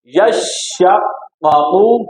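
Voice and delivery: यशक्कू very slow, yash-shaq-qa-qu, long hushing sh; both doublings held. Shown as a demonstration. Teacher models six sounds from four letters, very slow recitation. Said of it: "दोनों को दो दो बार मरतबा पढ़ना है".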